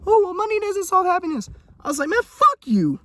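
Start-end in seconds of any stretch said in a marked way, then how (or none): 0.1-1.5 very high, whiny mock preachy voice; mocking people who say money can't buy happiness; mocking imitation. 2.1-3.0 mock defiant clapback; defiant retort. Retorting to the money critics.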